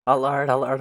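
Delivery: loud urgent alarm chant; mock emergency siren call. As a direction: sound the alarm, loud and pressing. [name] calling an urgent alarm.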